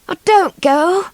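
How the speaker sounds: high, slow pleading plea; begging. A narrator's reading. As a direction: beg him not to leave, slowly. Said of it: "begged Swallow".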